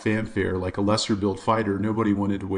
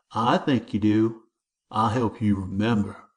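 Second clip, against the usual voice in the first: bully's threat. A narrator's reading. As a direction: lean in and threaten.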